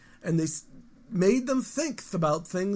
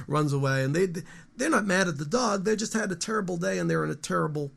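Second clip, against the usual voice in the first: ordinary delivery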